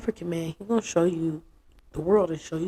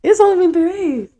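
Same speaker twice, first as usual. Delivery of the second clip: high, loud, disbelieving cry; exasperated repeat; 12 semitones higher, 7 dB louder than usual. Real, not acted. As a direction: hit it higher in outrage. Repeat, even higher.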